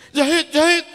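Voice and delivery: high, loud shout after someone; acted urgent call from afar. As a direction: holler the name to stop them leaving. Chasing Zahid, calling him back.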